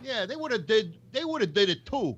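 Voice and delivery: thick New York accent. Real, not acted. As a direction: say it like a New Yorker. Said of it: "Using your New York accent again?".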